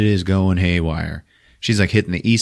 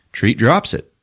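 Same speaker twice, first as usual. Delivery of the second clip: usual speaking voice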